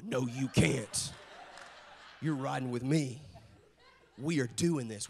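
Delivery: low, slow, firm dad veto; mock-stern fatherly refusal; stubborn refusal. Stubborn dad refusing daughter, acted.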